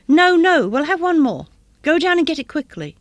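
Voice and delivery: loud, high, urgent cry; frantic insistence. A narrator's reading. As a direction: insist wildly, swooping high on each phrase. Frantic wife urging husband to act.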